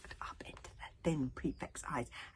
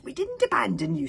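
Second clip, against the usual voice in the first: louder, squeaky protest; squeak; 3 semitones higher, 11 dB louder than usual. Acted. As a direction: squeak out a nervous denial. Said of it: "squeaked Fishlegs".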